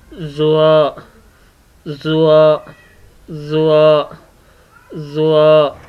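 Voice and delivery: ज़्वा thick and heavy, tongue tip on the upper gum; heavy letter demo. Shown as a demonstration. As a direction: repeat ज़्वा heavily for the class. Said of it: "इसको मोटा पढ़ा जाता है".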